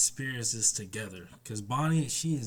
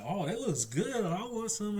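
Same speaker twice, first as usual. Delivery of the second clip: put-on much higher, childlike wanting voice; greedy giant; 9 semitones higher than usual. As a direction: beg for the food like a kid. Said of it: "Dory Barker is like".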